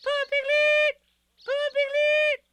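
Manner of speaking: very high, loud little squeal; shrieking small squeak from up the tree; excited squeak. A narrator's reading. Roo spotting Piglet below, excited squeaking. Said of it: "Something very excited ... squeaked".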